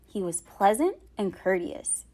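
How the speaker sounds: usual speaking voice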